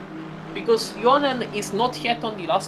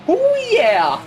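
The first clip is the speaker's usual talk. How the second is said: high, loud cowboy whoop; 11 semitones higher, 8 dB louder than usual. Pretend. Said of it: "You sound like a cowboy".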